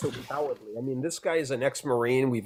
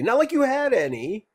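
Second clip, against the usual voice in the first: sarcastic jab; 11 semitones higher than usual. Real, not acted. Jab at caller J-Rock.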